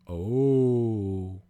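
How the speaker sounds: long, loud group ooh; collective oh. A narrator's reading. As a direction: draw out a chorus of realisation. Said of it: "gave a collective".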